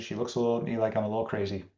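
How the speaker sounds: ordinary talk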